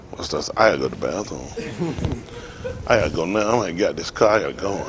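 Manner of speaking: hushed, urgent, as if stuck in traffic needing a toilet; low muttered panic about needing the bathroom; quiet desperate mutter. Retold or imitated. Desperate need to pee in traffic.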